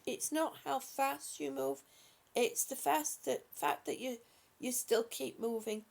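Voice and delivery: ordinary delivery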